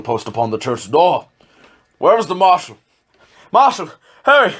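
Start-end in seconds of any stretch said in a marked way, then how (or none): 2.0-4.5 much higher, loud call across the room. Acted. Official calling the marshal to hurry, loud call. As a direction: hail the marshal urgently.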